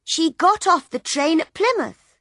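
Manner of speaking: put-on piping up like a little girl. Little girl telling Bill, high child voice. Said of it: "The little girl looked at Bill".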